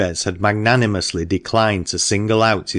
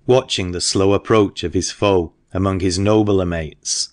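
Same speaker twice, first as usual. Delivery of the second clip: ordinary delivery, nothing marked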